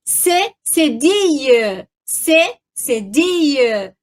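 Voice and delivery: "C cedille" drawn out very slowly, twice; dictating "C cedille" very slowly; slow spelling demo. Shown as a demonstration. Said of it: "olha só".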